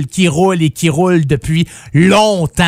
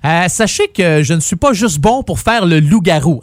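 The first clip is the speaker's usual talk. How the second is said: ordinary talk, nothing marked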